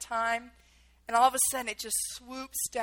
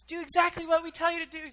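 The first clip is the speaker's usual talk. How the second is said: higher-pitched shouted order, mildly acted; retold screaming; 6 semitones higher, faster than usual. Soldiers instructing captives over helicopters, retold raised voice. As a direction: imitate orders called over rotor noise. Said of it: "screaming 'cause it's so loud with the helicopters".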